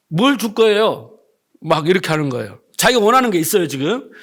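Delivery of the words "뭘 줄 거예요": mock-annoyed, drawn-out demand; a touch of retold anger, slowly; mildly angry. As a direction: imitate an irked man demanding an answer. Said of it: "좀 화가 났어요".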